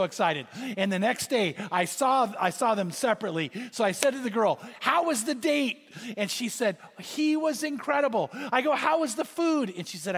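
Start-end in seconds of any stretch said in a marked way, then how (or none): 4.8-5.8 higher, eager retold question. Pastor asks girl eagerly. 7.0-8.3 put-on higher, a touch of gushing girl. Girl gushes about date. 8.7-9.7 higher, eager retold question. Pastor asks about food.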